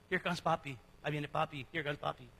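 low, soft offhand mention; in passing. Retold or imitated. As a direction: toss it off quietly, as if in passing. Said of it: "When it's just in passing".

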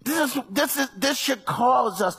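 loud, high preaching shout; full-force preaching. Acted. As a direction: preach it hard to the church.

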